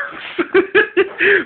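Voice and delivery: high burst of laughter; disbelieving laugh. Real, not acted. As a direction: laugh it off, stunned.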